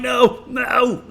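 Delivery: high yelled no no in mock panic; panicked shout. Recalling the spill, yells in mock panic. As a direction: yell it like your music is at risk. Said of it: "I'm like".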